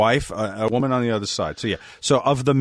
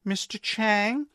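high, drawn-out question, softer; forced, tentative call; 11 semitones higher, 5 dB quieter, much slower than usual. Retold or imitated. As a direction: imitate a nurse calling out while avoiding the obvious person. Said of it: "like, forcing herself to look".

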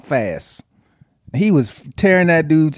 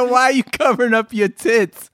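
higher-pitched mimicked question; imitating the wife; 9 semitones higher than usual. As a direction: mimic her teasing question up high. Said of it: "She said".

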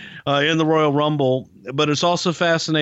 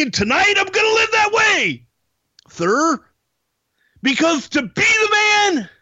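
boastful wrestler promo; 12 semitones higher than usual. Acted. Champ boasting before the title match, high shouted promo. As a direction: holler it high, stretching the big words.

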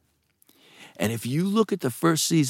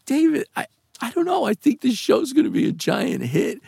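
retold pleading in a raised pitch; high-pitched protest; 14 semitones higher than usual. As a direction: plead your case, voice way up. Retold protest to boss while upset.

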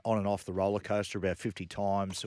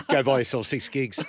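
laughing tease; 4 semitones higher, 7 dB louder than usual. Real, not acted. Teasing mate about data costs.

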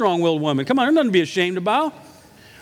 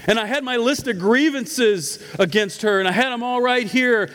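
normal talking voice

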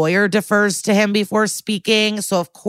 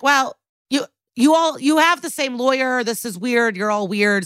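higher-pitched, exasperated accusation; mock accusatory; 6 semitones higher than usual. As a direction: imitate a flustered detective confronting suspects. Acted accusation in a quarrel.

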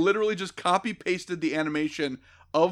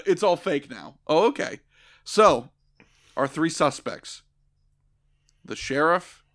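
ordinary talk

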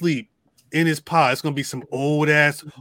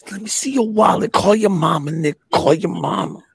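louder, threatening inmate voice; menacing inmate; 3 semitones higher, 5 dB louder than usual. Retold or imitated. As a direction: lean in with menace. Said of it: "That's when he puts him".

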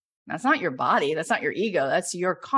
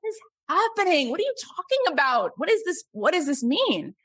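high, incredulous string of questions; stunned disbelief; 8 semitones higher than usual. Retold or imitated. Watching shaman set paper alight, high stunned disbelief. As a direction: gasp it out, floored. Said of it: "And I was like".

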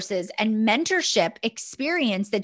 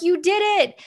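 high-pitched retold cheer; cheering friends; 8 semitones higher than usual. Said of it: "they were cheering us on".

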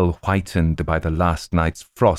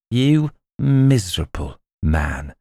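slow, scornful goblin sneer; milder first sneer, drawn out; contemptuous; slower than usual. Acted. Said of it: "in a tone of excessive contempt".

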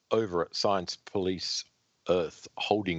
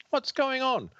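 pitched way up, alarmed; high voice for the girl; high female voice. Acted. Satin Girl answering call.

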